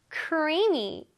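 Creamy drawn out and careful; pronunciation model. Shown as a demonstration. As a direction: model Creamy with a long first vowel. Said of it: "자, 한번 발음해 볼게요".

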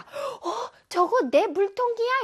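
high-pitched gasp, then a startled question; shocked discovery. Acted. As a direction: catch a friend drinking from your bottle. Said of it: "다른 친구가 막 벌컥벌컥 마시고 있다".

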